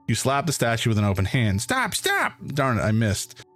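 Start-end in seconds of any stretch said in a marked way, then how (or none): 1.6-2.3 panicked squeak. Acted. Ant pleads.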